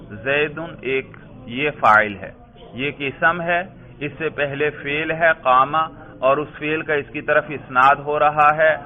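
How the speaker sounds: usual speaking voice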